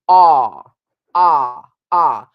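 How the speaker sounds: very loud "ah" with the mouth wide open. Shown as a demonstration. Coach showing students how to open the vowel. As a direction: drop the jaw for each "ah". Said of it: "You need to open".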